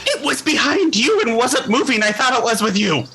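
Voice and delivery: high-pitched, flustered protest; agitated retort. Acted. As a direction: fire back in a flustered high voice. Gnome argues back in panic.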